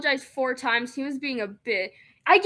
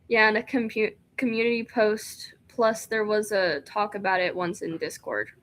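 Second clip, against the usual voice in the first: usual speaking voice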